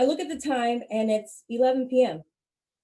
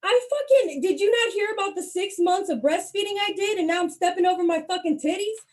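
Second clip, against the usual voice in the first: indignant rant; 8 semitones higher, 4 dB louder than usual. Acted. Ranting at nosy classmates.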